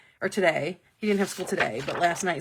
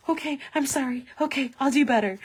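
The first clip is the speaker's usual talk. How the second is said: lightly voiced apologetic player, a bit higher; contrite quarterback; 5 semitones higher than usual. Quarterback taking it after doing badly, mock contrite. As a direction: take the criticism like an eager, sorry pup. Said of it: "takes the".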